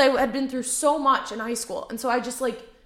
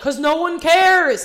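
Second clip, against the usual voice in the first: angry; 7 semitones higher, 9 dB louder, slower than usual. Retold or imitated. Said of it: "actually mad".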